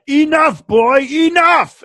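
imitated angry bellow, high and loud; mock-furious high shout; genuinely mad. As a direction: bellow it like a fed-up commander, last word drawn out. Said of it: "genuinely mad. Like, he's really, really mad".

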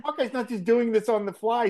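talking through a laugh. Real, not acted. Laughing to his co-host about Hawkeye. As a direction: still giggling mid-sentence. Still laughing over the scene.

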